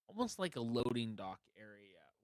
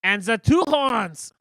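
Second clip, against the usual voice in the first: guard voice; 10 semitones higher, 16 dB louder than usual. Acted. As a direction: bellow like a pompous royal guard.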